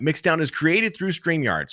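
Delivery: slow promo read of the tagline; match the inflection of the recorded take; voiceover read. Shown as a demonstration. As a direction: ad copy read, even and slow. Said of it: "So I just kinda remember that".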